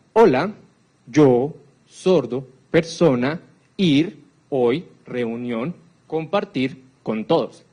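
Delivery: very slow, one isolated word at a time; word-by-word sign gloss. Shown as a demonstration. Lecturer imitates a deaf signer's word order, very slow and word by word. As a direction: drop all the little words, like glossing sign language. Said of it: "Te boto palabras, te digo así".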